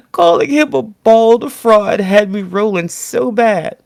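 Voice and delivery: high, slow, laughing through it. Real, not acted. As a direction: still cracking up at the joke. Laughing after the quote.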